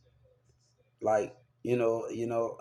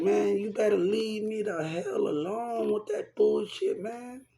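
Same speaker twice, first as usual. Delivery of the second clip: high, raised voice telling her off; heated warning; 8 semitones higher than usual. Retold or imitated. Telling the girl to back off, heated and high. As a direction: warn her off, fed up. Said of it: "I'm like".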